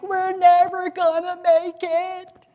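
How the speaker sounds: high, slow, imitating despair; mock wail of hopelessness; slow pleading cry to husband Mike. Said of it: "I'd even cry to Mike".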